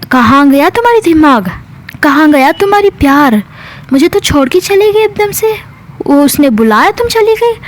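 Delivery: hurt, accusing questions pitched high; acted reproach. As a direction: scold a partner who walked off, mildly dramatized. Acted jealous scolding of partner. (नाराज़गी भरी शिकायत)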